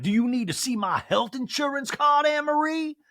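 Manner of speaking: high-pitched mock indignation; indignant Sutton. Acts out Sutton's indignant retort.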